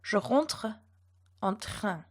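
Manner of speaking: "Je rentre en train" with throaty French uvular R; "Je rentre en train" with uvular fricative R. Shown as a demonstration. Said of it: "So the first sentence would be pronounced".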